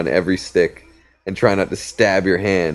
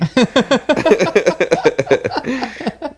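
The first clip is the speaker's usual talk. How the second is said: high cackling laugh. Retold or imitated. Manny's laugh.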